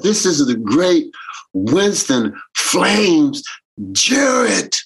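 slow, stretched name rising high at the end; grand introduction. Retold or imitated. Introducing Winston to the fans, slow grand announcement. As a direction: announce the name like a master of ceremonies.

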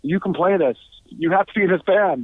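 high-pitched, urgent retold plea; begging. Bandmates begging the drummer to join, pleading. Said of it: "him and Simon were begging me".

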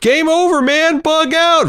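high, slow panicked quote; movie panic line. Retold or imitated. Podcaster mimicking a war film coward, panicked. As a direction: wail it like a doomed soldier in a war film.

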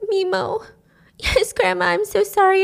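high, distressed acted plea; tearful pleading. Mimo to her bleeding grandma, high pleading apology. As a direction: beg in a high, grieving voice.

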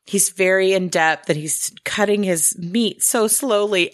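usual speaking voice

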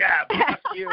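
high, mock-indignant jab; playful high curse. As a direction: tease a rival with a high curse. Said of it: "I'm like".

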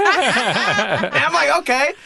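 high laughing okay. Real, not acted. Laughing at the agent's verdict.

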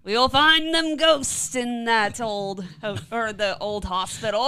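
put-on slow old-timer voice; slow, drawn-out gold-rush prospector. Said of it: "Did you turn into an old prospector?".

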